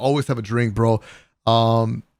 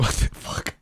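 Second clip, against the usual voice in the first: whispered disbelief; 5 dB quieter than usual. Retold or imitated. Retold reaction to candy offer.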